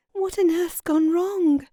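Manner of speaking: put-on a girl's alarmed question, not too frightened; mildly frightened. A narrator's reading. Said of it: "in a frightened voice".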